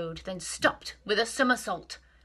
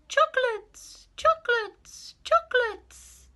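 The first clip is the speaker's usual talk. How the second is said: very high, slow echoing voice; cave echo; 4 dB louder, slower than usual. Acted. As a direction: let it ring back from the cave. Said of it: "the voice from the cave replied".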